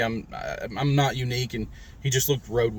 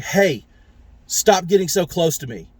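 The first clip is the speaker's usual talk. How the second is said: a louder, sharper retold rebuke; angry, brazen; 4 semitones higher, 5 dB louder than usual. Past self to a stranger crowding him, angry. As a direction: snap at the stranger, agitated. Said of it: "I was so brazen and so angry and agitated".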